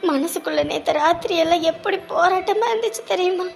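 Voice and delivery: heavy-hearted confiding. Acted. Wife confides her worry about her husband, low and sorrowful.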